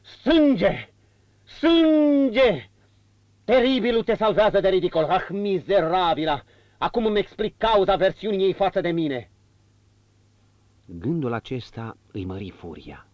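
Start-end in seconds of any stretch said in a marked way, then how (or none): 0.0-0.9 loud, high cry of Sânge; betrayed husband crying out Sânge; muffled cry. A narrator's reading. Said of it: "strigă cu voce surdă". 1.4-2.7 louder, higher, drawn-out Sânge; husband crying out Sânge louder; stronger cry. A narrator's reading. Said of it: "strigă cu voce surdă". 3.4-9.3 loud, high, raging tirade; betrayed husband raging at his wife; bitter outburst. A narrator's reading. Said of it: "strigă cu voce surdă".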